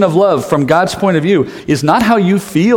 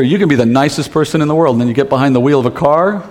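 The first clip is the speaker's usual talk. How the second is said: normal talking voice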